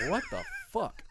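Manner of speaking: mock squeal of total surprise; stunned at the new meat market sign; retold shock. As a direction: pitch jumps way up in stunned disbelief. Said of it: "I am just shocked".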